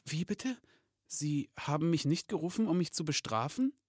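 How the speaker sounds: astonished. Acted. Elf realising Santa won't punish him, astonished. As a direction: ask in hesitant disbelief. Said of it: "Packet verwundert aufblickte".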